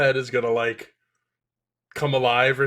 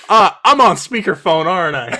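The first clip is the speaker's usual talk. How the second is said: louder, higher indignant outburst; loud mock realisation; 4 semitones higher, 8 dB louder than usual. Imitating Mordecai catching them laughing, loud indignant realisation. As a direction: blurt out the accusation, hammed-up.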